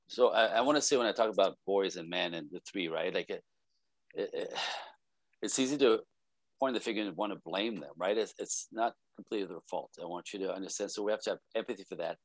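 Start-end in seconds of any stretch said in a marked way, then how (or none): none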